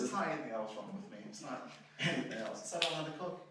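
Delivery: normal talking voice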